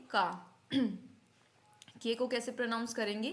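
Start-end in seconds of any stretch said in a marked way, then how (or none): 0.1-0.6 German K named का, not "kay"; German letter K. Shown as a demonstration. Teacher answering on German K, का as "kah". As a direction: say का as open "kah". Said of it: "के को क्या बोलेंगे?".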